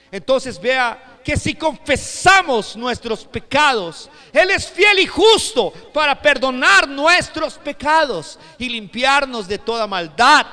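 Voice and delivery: slow, loud, high-pitched proclamation; full-force preaching. Real, not acted. Preacher proclaiming forgiveness from scripture, full-force preaching. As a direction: hammer out the verse phrase by phrase.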